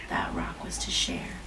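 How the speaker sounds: whisper. A narrator's reading. Storybook hushed ending line.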